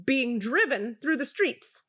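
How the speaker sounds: ordinary delivery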